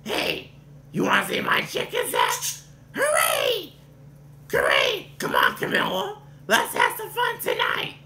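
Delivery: wild, raspy weirdo voice with squeals; frantic strained Gonzo, yelling; Gonzo impression. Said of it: "Then the other one is Gonzo".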